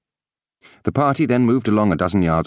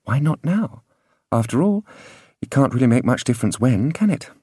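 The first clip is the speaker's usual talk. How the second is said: inner voice; 6 semitones higher than usual. A narrator's reading. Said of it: "An inside voice seemed to prompt".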